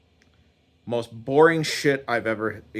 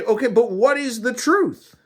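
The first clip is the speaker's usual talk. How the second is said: high, insistent question; exasperated demand; 11 semitones higher, 4 dB louder than usual. Acted. Man pressing God for the truth, exasperated. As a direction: press for the answer, voice pitched up.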